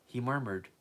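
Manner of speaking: usual speaking voice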